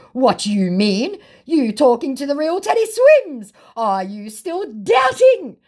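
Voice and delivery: hammed-up incredulous scammer; mock incredulous scammer. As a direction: play him outraged at being doubted. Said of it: "Totally incredulous".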